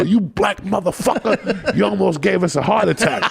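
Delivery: a hint of scared men cursing; lightly voiced startled prisoners; startled inmates. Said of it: "would duck and go".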